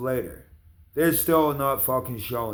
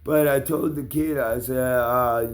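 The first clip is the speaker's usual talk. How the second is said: normal talking voice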